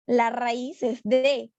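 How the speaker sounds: "de" drawn out very slowly; slow, deliberate explanation. Shown as a demonstration. Teacher to class, slowly stressing "de". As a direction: spell out "la raíz es de" slowly, word by word. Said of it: "Si yo le quito ar".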